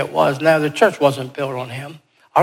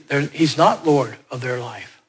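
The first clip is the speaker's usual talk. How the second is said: ordinary talk, nothing marked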